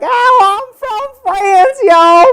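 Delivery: high, loud hammed-up country voice, slow; mock her real Texas drawl, loud; mocking Southern twang. Guest mocks a castmate's real accent, hammed-up twang. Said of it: "She's like".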